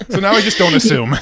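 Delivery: laughing at your own mistake; real laughing. Real, not acted. Telling podcast guest about a wrong guess, laughing.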